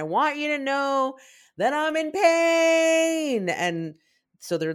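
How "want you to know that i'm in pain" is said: high, loud mock scream stretched on the last word; imitated screaming. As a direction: wail it to the whole world, imitating. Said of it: "them just screaming to the world".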